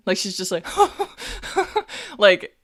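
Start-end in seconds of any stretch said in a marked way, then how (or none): 0.7-2.1 high burst of startled laughter; nervous laughing. Acted. Player voicing Iffy as an arrow whistles past, surprised laughing. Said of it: "she starts laughing".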